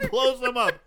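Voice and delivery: ordinary talk, nothing marked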